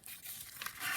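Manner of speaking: exhausted breathy sigh; long weary sigh; tired sigh. Real, not acted.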